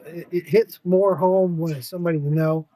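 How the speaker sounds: usual speaking voice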